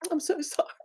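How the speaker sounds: whispered apology, choked up; tearful whisper. Real, not acted. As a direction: barely get the words out. Apologising while overcome with emotion.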